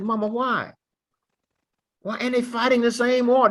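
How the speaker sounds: high-pitched, incredulous why; pleading child's question. Retold or imitated. Boy asks mama about the segregated military, high and incredulous. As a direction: press Mama for an answer, voice high.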